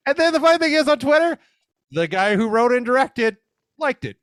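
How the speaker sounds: can't believe it, thrilled; high-pitched amazed storytelling; excited. Real, not acted. Excited about the director's like.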